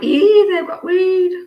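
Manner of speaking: high, loud, drawn-out "Ee"; mock-scandalised exclamation. Streamer recalling smelling weed out and about, mock-scandalised. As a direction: exclaim it high and slow, hamming up the discovery. Acting own reaction to a smell.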